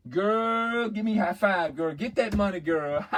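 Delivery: put-on loud, high girl-to-girl cheer; excited girlfriend. Girl asking her friend for a high five, hammed-up excitement. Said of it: "the, the girl be".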